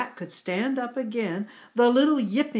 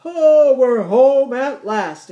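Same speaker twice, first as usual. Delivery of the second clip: loud, slow, relieved cry of home; thankful exclamation; 4 semitones higher, 10 dB louder, slower than usual. A narrator's reading. Grandpa Yak relieved to be home, loud thankful exclamation. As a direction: sigh out your relief at being home. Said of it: "exclaimed with a thankful sigh".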